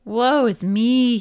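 loud, slow, high mock lament; wailing. A narrator's reading. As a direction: wail it out slowly, playing helpless. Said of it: "he wailed".

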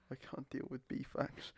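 exasperated whisper. Real, not acted. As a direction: breathe it out, done with her. Exasperated whisper after laugh.

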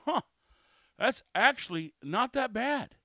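mildly surprised verdict after a first sip; pleasantly surprised customer. Acted. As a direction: react like a skeptic who likes it. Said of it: "They take a drink and they go".

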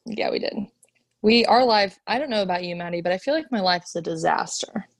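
normal talking voice

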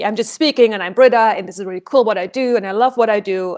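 puffed-up overbearing loud delivery; third circle energy. Shown as a demonstration. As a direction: broadcast at them, not to them. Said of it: "So this would be a third circle energy".

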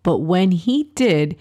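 ordinary delivery

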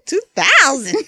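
high-pitched, laughing incredulity; laughing mock astonishment; retold disbelief. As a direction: echo it in amused shock, voice jumping up. Retelling shocked reaction to queue number.

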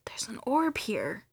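slow, hushed awe; retold spooky whisper. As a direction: breathe it out as a secret. Seeing an orb in photo.

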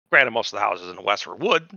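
ordinary talk, nothing marked

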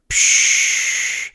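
long voiceless diving-gear hiss; imitated breathing hiss. A narrator's reading. Theobald about to speak underwater, imitated airy hiss. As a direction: let out a long rushing "psch" of air.

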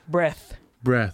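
"Breath" said alone, ending clearly in th; corrective enunciation. Shown as a demonstration. Correcting a friend who heard brush, corrective enunciation. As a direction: pronounce "Breath" firmly, with a th, not sh.

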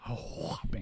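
hushed, slow, drawn-out mock hype; self-parody announcer. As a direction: breathe it out slowly, mock-dramatic. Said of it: "I've heard you say a whopping".

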